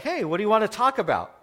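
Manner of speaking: a lightly voiced friendly mentor; cheery mentor greeting. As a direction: play Bob's cheerful welcome.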